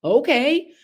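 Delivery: put-on kid excitement. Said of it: "I'm like".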